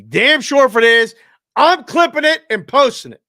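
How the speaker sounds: loud gleeful vow to post it; excited boast. Real, not acted. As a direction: promise it like you're already gloating. Bragging about a final score.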